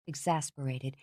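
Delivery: usual speaking voice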